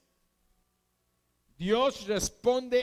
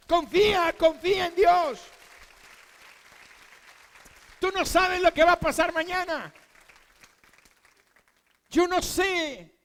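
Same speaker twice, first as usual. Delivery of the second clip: higher and louder, fervent preaching; preaching at full force; 6 semitones higher, 6 dB louder than usual. Acted. Preacher urging the congregation to trust God, fervent full-voice preaching.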